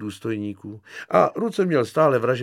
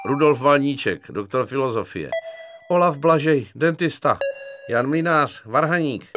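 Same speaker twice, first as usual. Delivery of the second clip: brisk introductions; 5 semitones higher, 5 dB louder than usual. Acted. Patients introduce themselves one by one.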